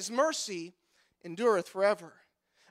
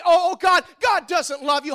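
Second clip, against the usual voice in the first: higher, louder mock wail on the first "Oh, oh"; mock naysayer; 4 semitones higher, 6 dB louder than usual. Preacher parodying the negative world, loud mock wail. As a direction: ham up the negative world's cry. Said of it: "our negative world today say".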